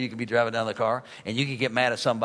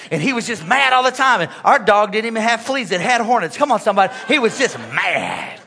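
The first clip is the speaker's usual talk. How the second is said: loud, raised preaching; preaching hard; 6 semitones higher, 6 dB louder than usual. Acted. Preacher telling the church about his angry father, loud. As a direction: build the story with fire.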